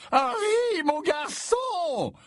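very high, slow jovial bellow; booming old professor. A narrator's reading. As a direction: boom out a hearty welcome. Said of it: "d'une voix de stentor".

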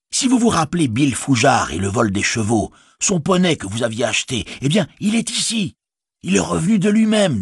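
a worried village innkeeper speaking up; innkeeper Butterbur. Acted.

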